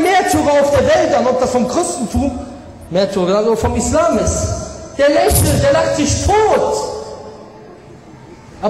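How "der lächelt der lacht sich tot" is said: fervent preaching. Real, not acted. Preacher praising martyrs.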